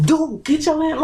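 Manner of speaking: high-pitched retold protest; insist, voice pitched well up; trying to be forceful. Teen grabbed by a police officer, acted out trying to be forceful. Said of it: "trying to be real forceful".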